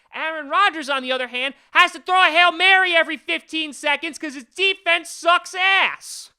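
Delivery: loud, high rant building to a shout; real excited rant. Real, not acted. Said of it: "got a little excited there".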